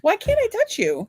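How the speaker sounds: drawn-out, high whine; whiny teasing. Real, not acted. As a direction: plead playfully, stretching it out. Playfully poking at husband.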